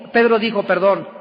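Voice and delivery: ordinary talk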